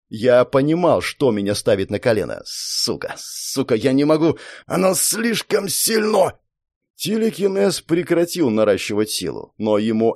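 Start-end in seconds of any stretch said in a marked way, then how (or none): 2.4-6.4 breathless, pained whisper rising high; strained whispered curse. Acted. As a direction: gasp it out under crushing weight. Hero crushed, cannot resist.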